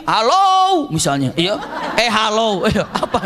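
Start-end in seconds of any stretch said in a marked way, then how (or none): 0.1-0.9 loud high hello; booming shouted hello. Shown as a demonstration. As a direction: call it out across a field. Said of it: "Jadi kalau teriak di sini".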